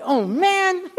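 high-pitched "oh, man" of someone left out; mock dismay. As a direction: act out comic disappointment. Acts out being left out.

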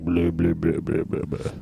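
low, fading blah blah; groggy mumbling. Retold or imitated. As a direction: mumble it like a sick zombie. Describing feeling awful at party.

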